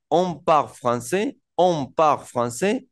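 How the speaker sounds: "On parle français" despacio, con subida; francés lento y claro. Shown as a demonstration. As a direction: repite la frase despacio. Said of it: "yo puedo decir". (profesor modela "On parle français")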